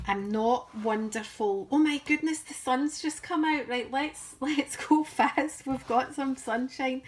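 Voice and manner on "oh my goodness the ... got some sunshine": higher, louder burst of real excitement; excited surprise. Real, not acted. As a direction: exclaim at a sudden, welcome interruption. Sun suddenly comes out mid-recording.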